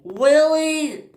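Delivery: high-pitched exclaimed word; scream. Acted. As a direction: cry it out high, in protest. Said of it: "screamed Rocky".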